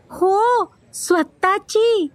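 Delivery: put-on high little-girl boast; proudly showing off. A narrator's reading. Pundi answers her friends about her bicycle, proud boast. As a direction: boast in a high child's voice, stretching the last word. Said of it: "पुंडी ऐटीत म्हणाली".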